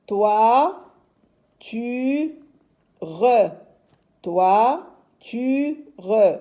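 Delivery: "Toi, tu, re" in three separate beats; syllable by syllable. Shown as a demonstration. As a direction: sound out "Toi, tu, re" with pauses, twice. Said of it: "troisième syllabe".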